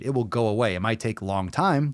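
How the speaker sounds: normal talking voice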